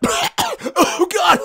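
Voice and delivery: very high, hammed-up choking cry; fake dying gasp. Describing a prank on rude shoppers, hammed-up dying cry. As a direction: pretend to cough up blood. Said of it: "just be like".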